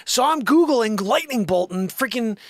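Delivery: usual speaking voice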